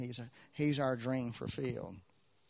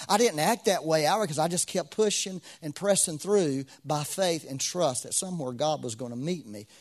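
ordinary talk

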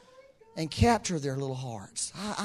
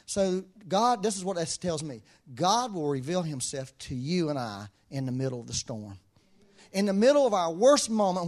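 usual speaking voice

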